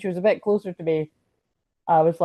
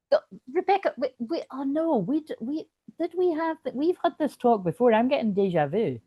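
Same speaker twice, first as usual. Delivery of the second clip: surprised; 8 semitones higher than usual. Real, not acted. Realizes they've discussed this before.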